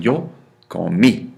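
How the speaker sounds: slow "comí", weight on the final í; stress on final I. Shown as a demonstration. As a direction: hit the last syllable of "comí". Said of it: "an I with an accent on it".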